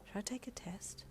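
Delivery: breathy whisper to yourself; wondering. A narrator's reading. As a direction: whisper the question, unsure. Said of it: "you wondered".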